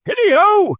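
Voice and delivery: a fat, jovial boss hollering for his apprentices. A narrator's reading. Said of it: "cried old Fezziwig".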